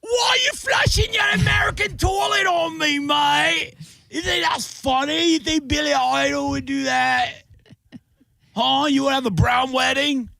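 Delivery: very high, wobbly mock-British voice; hammed-up British accent. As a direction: squeal it in a bad British-Australian accent. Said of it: "I don't know where that accent's going".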